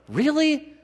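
high squeaky disbelief; a shocked, high-pitched really; mock disbelief at the command; incredulous. Incredulous reaction to God's command.

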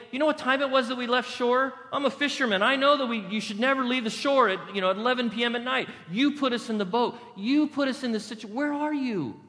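high-pitched indignant rant; aggrieved disciple. Acted. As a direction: protest in exasperation as a blaming fisherman. Acts aggrieved fisherman disciple in boat.

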